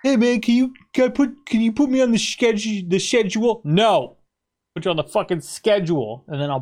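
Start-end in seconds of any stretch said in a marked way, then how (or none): 0.0-3.6 high mock British bloke asking. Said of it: "You go".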